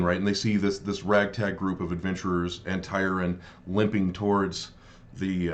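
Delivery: normal talking voice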